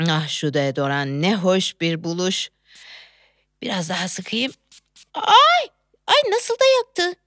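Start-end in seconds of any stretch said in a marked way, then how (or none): 5.1-7.2 yelp of pain. Acted. Deodorant stings her.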